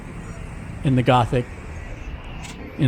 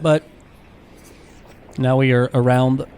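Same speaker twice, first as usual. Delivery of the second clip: normal talking voice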